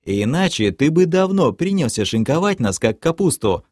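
ordinary delivery, nothing marked

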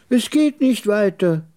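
put-on high-pitched old trader, emphatic; high, firm character voice; old camel trader. Old camel trader answers narrator.

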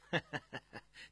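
quick breathy run of chuckles; light chuckle. A narrator's reading. Aide chimes in beside the prince, chuckling. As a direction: chuckle from the sidelines. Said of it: "戴铎在旁笑道".

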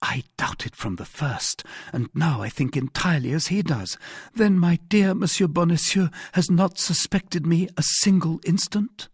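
lightly voiced woman, climbing high; hopeful heroine. A narrator's reading. Wife wonders if her husband trusted her, lightly eager.

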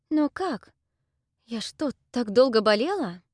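high, astonished questions; surprised. Acted. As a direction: ask in disbelief. Heroine astonished to see Salina. (удивлённо)

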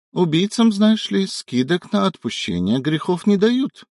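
high, sly and knowing. A narrator's reading. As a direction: voice it up high, wryly. Angel's thought, high voice.

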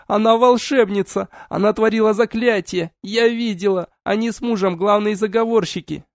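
very high, frantic pointing scream; frantic accusing shout. A narrator's reading. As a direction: denounce her in panic. Mother frantically denouncing.

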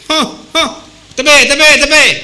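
a mock officer's shout; calling out to stop. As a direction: wave the driver down loudly. Policeman flagging car down.

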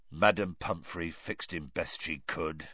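dull, low and soft. A narrator's reading. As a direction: say it flat and defeated. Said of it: "said Hagrid dully".

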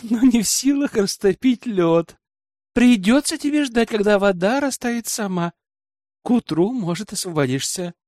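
lifted pitch, mock-helpful; amused through laughter. A narrator's reading. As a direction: tease her with laughter still in the voice. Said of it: "сквозь смех ответил я".

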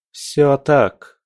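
твёрдый, высокий, категоричный ответ; отрежь, не допуская возражений; отрезала. A narrator's reading. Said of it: "отрезала Алина". (категорично, повышенным тоном)

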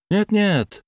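quick high cut-in; hasty interruption. A narrator's reading. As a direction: hurry to cut him off. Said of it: "поспешно перебил".

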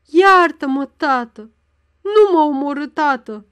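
loud terrified pleading cry; anguished screams. A narrator's reading. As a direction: scream for mercy from the father. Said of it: "Țipetele Anei se întețiră iar". (țipete deznădăjduite, implorând iertare)

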